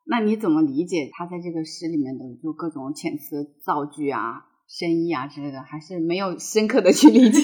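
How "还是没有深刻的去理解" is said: laughing. Real, not acted. Teasing question, laugh heard.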